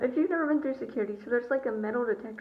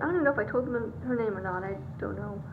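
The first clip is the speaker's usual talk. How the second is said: ordinary delivery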